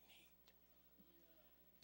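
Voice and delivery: breathy whispered final word. Acted. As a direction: drop to a hush on the word. Sermon line trails into hush.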